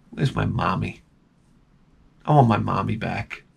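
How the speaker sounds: understated fake whimper for mom; whimpering for mommy. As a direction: whine like a lost little boy. Mock crying for mommy.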